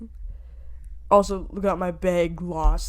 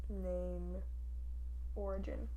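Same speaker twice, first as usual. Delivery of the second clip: ordinary talk, nothing marked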